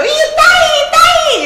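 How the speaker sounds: very high, loud mock squeal; hammed-up high-pitched Japanese cry; imitated Japanese porn cry. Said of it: "like how they always go".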